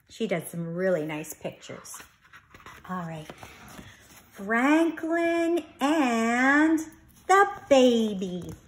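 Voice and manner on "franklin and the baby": slow, drawn-out title read; title read to children. A narrator's reading. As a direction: announce the book title slowly.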